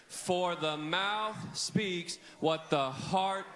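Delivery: very slow, even chant of the verse; drawn-out unison recital; slow group recitation. Shown as a demonstration. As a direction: lead the room in saying it together. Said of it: "let's say it together on the count of three".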